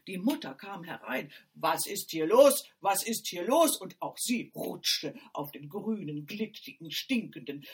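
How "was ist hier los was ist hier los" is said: loud, alarmed mother; mother bursts in and calls out in alarm. A narrator's reading. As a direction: demand what is going on.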